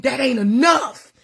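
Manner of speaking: usual speaking voice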